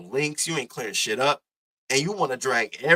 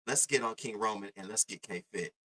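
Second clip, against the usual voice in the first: normal talking voice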